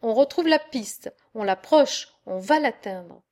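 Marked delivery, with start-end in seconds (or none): none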